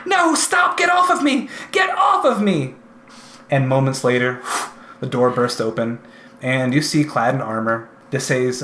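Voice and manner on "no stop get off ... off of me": high, loud panicked screams, much higher and louder; woman screaming. Acted. DM voicing a woman screaming from the homestead, high terrified shouting. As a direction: shriek it like she's being dragged off. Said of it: "the voice of a woman screaming".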